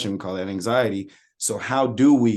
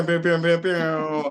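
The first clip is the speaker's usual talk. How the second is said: higher mock laser pew sounds; playful imitated pew pew; soundboard pew effect; 7 semitones higher, slower than usual. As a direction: fire off soundboard laser pews. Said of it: "how they be having the pew".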